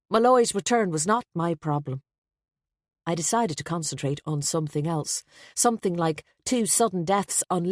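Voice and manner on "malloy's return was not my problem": ordinary delivery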